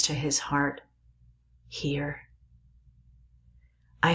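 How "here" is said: a breathy whisper of a dying man. Acted. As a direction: barely breathe the word out. Dying man at his heart.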